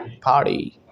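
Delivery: "पार्टी" said as par-dee; t as light d. Shown as a demonstration. Teacher demonstrates American sound, "पार्टी" with a light d. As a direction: soften the t of "पार्टी" into d. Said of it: "टी में हल्का सा डी add".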